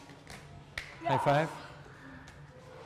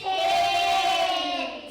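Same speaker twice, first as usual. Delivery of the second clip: shout. Real, not acted. Excited answer to teacher question.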